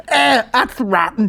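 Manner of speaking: put-on high, slow childish whine; mock a small girl's disgust; wee girl. Retelling the wee girl at the gardens, high child voice. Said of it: "the wee girl was like".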